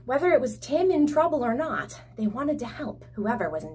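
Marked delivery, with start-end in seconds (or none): none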